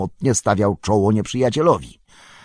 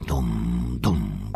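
low, softer droning boom; imitated drumbeat; 8 semitones lower, 5 dB quieter than usual. A narrator's reading. Narrator sounds the drums in the deep, low drone. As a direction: intone it like deep war drums.

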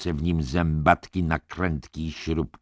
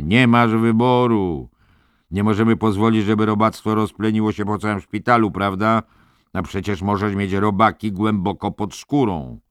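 loud, higher, pushing orderly voice; menacing orderly; 5 semitones higher, 5 dB louder than usual. A narrator's reading. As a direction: coax and threaten him at once. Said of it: "jakby ze smutkiem w głosie". (groźny sanitariusz, głośno i wyżej)

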